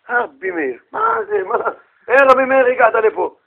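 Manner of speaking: flustered, high-pitched surprise at a guest; surprised host. Acted. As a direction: stammer as if caught off guard by an honored visitor. Startled host at the door.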